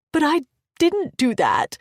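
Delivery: high, loud protest; crying out. Acted. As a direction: burst out in denial. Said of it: "I cried".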